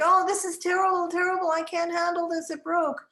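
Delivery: high, loud mock wail; hammed-up despair, like a distraught child; mimicking child's screaming. Teacher mirrors boy over broken construction, high mock screaming. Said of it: "I went along with it".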